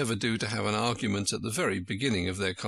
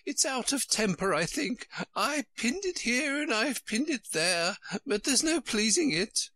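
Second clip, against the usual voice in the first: high, woeful Queen voice; high-pitched storybook melancholy; 11 semitones higher than usual. A narrator's reading. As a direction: lament it helplessly in a high royal voice. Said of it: "said in a melancholy voice".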